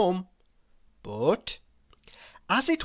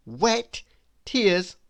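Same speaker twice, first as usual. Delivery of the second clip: ordinary delivery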